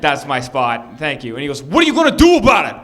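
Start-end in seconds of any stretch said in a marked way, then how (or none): 0.0-1.0 low, polite claim; mild, polite objection. Retold or imitated. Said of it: "very jovial and very polite". 1.0-1.4 polite, mild-mannered objection. Retold or imitated. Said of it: "very jovial and very polite". 1.6-2.8 loud, fast, high-pitched taunt; hammed-up belligerent yell; aggressive challenge. Retold parking-spot confrontation.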